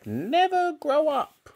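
very high, slow granny voice; menacing grandmother. A narrator's reading. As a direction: stretch out the command as a mean old woman. Grandmother ordering George about.

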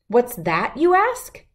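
ordinary delivery

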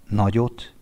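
ordinary delivery, nothing marked

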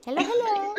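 high, loud sing-song hello; calling out. Real, not acted. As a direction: call out cheerily to the room.

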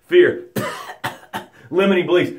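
coughing up water after near drowning; mock coughing. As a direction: spit out doubt with coughs. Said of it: "start to spew out doubt".

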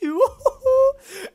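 shrieking laugh of relief; high laugh. Real, not acted. Gamer laughing after escaping the lion, high laugh.

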